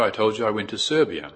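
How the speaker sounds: ordinary talk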